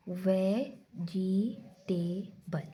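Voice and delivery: वेजिटेबल whispered slowly, syllable by syllable; whispered syllable demo; syllable breakdown. Shown as a demonstration. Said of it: "ये देखें".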